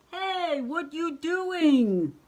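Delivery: very high, loud and slow monster protest; drawn-out monster yell; indignant monster. Acted. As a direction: shout at the tree as a robbed monster. Cookies pulled away.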